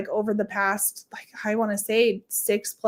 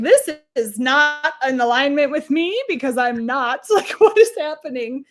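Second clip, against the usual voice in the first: incredulous mock complaint; 5 semitones higher, 3 dB louder than usual. Mocking her past self's complaint.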